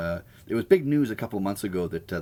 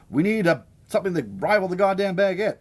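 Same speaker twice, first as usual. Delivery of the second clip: mock indignant Italians; 11 semitones higher, 5 dB louder than usual. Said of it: "and they're like".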